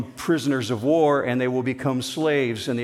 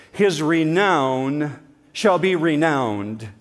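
slow, weighty delivery; solemn emphatic reading; 2 semitones higher, 4 dB louder, much slower than usual. Shown as a demonstration. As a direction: stretch out each word. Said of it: "here's a good Hebrew summary".

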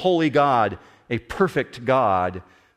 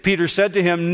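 ordinary talk

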